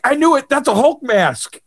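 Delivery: high, delighted nurse voice; excited. Retold or imitated. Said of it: "She's like".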